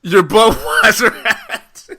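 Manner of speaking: can't believe it's about the hat; laughing disbelief. Real, not acted. S1 repeats in disbelief.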